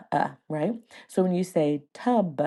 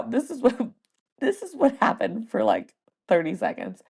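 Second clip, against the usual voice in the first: ordinary talk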